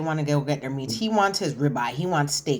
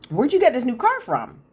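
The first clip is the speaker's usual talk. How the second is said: higher, louder mock demand; retold suspicious questioning; 7 semitones higher, 5 dB louder than usual. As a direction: confront her, imitating a suspicious husband. Suspicious husband confronts wife about car.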